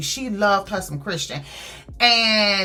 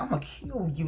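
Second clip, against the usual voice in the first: hushed, low-volume whispered threat, imitated; retold whisper; 3 semitones lower, 11 dB quieter than usual. Woman warning the man on stage, imitated whisper. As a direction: drop right down as if whispering a warning. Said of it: "like, whispering".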